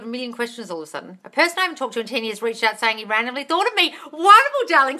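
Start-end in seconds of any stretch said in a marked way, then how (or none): 4.1-5.0 much higher, louder gush; gushing delight. Real, not acted. Gushing at a viewer's news. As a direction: beam it out.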